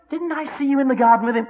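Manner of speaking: high, quick, pointed question; put-on high voice of a nosy bystander; suspicious accuser. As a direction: challenge him, pitched well up. Acted accuser questioning Peter.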